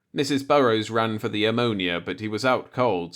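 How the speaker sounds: ordinary delivery, nothing marked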